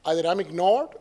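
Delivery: normal talking voice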